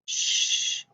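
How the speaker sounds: "sh" as a soft voiceless hiss with no voice; the "sh" sound from shark. Shown as a demonstration. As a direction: hiss the "sh" quietly, no vowel.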